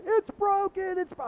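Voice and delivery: very high, loud panicked cry; full panic. Retold or imitated. Boy jumping up as mom comes home, full panic cry. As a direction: burst out crying it to mom. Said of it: "I was, like, in full panic".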